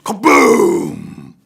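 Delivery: very loud, low, booming blast; explosive thunder boom. Acted. Lightning blasting the biggest oak, thunderous boom.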